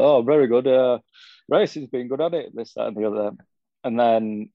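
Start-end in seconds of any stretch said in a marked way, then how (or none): 0.0-2.6 put-on northern accent. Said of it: "Rob's massively northern".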